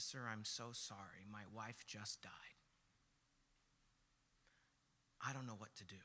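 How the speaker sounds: low, soft, broken-hearted apology; subdued grieving father. Retold or imitated. Widowed father apologizing to a passenger, quietly grieving. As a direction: imitate a bereaved man, voice dropped low.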